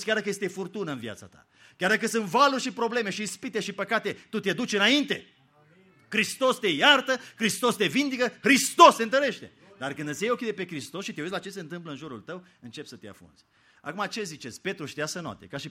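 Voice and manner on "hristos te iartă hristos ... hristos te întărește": loud, high, rising proclamation; preaching at full force. Acted. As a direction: build it like a climax. Climactic preaching declaration. (predică în forță, crescând)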